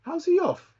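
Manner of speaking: very high outraged question; indignant disbelief. Real, not acted. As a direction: protest the call. Disputing offside call.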